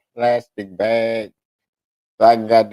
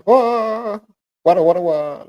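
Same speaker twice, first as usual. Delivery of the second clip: amazed bystanders; 9 semitones higher, 5 dB louder than usual. Retold or imitated.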